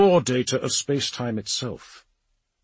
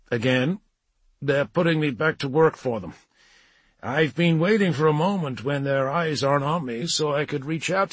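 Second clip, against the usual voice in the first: lightly voiced story character, raised in pitch; apologetic John Grammaticus; 5 semitones higher, 3 dB louder than usual. A narrator's reading. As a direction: apologise and explain, a bit higher than narration. Narrator voices John in dialogue.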